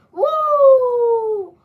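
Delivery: high, loud wrestler woo; Ric Flair woo. Retold or imitated.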